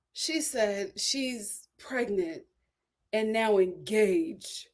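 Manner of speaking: slow, soft, despairing mimic of the mother; distraught mother. Imitating the mother saying her daughter is engaged, slow and distraught. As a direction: drag it out like a crushed mom. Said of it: "Like, she's so distraught".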